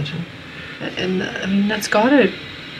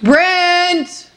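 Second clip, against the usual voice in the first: shrill-free high yell across the room; screaming fishwife; 12 semitones higher, 3 dB louder than usual. Real, not acted. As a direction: call out his name at full pitch. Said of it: "I sound like a screaming fishwife".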